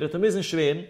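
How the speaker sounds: ordinary delivery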